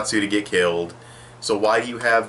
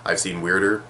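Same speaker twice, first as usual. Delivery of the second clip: ordinary talk, nothing marked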